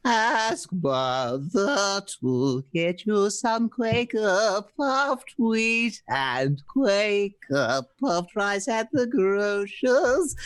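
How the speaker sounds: ordinary talk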